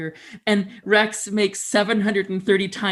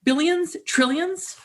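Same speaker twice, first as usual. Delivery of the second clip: high, wide-eyed questions; amazed; 13 semitones higher, 3 dB louder than usual. Acted. Speaker opening a talk, marveling at huge numbers. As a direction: marvel at numbers too big to grasp.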